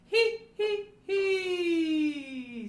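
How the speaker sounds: very high, loud he pulses. Shown as a demonstration. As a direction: punch high hes from the ribs. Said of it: "ha-has up in the range".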